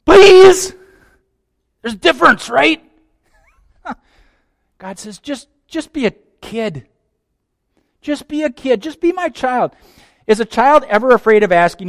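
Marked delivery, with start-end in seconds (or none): 0.1-0.8 very high, loud desperate cry; high loud urgent please. Acted. Contrast with self-reliance. 5.2-9.7 tender encouraging voice; gentle fatherly invitation. Acted.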